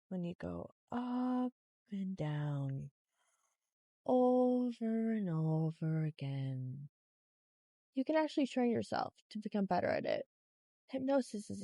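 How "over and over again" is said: normal talking voice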